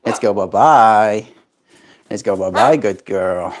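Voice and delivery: ordinary talk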